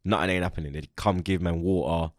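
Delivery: ordinary delivery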